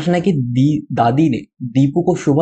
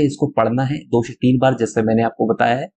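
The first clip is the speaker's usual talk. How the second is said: usual speaking voice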